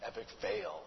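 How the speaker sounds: "Epic fail." whispered, no voice at all; whispered aside. Shown as a demonstration. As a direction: breathe "Epic fail." as a soft mocking aside. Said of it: "things are epic everything now".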